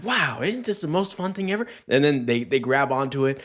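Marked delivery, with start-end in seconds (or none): 0.0-1.7 hyped-up dog talk. Retold or imitated. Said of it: "you're like".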